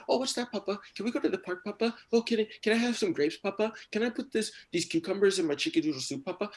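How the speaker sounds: put-on high-pitched little kid voice; chattering toddler. Three-year-old son badgering papa with questions, high eager chatter. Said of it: "He's just always talking. He's like".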